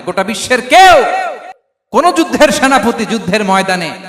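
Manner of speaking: loud, high preaching shout, easing off; shouted challenge. Real, not acted. Preacher challenging the world's army commanders, shouted and easing off. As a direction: throw out a challenge at full voice.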